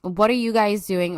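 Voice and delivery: ordinary talk, nothing marked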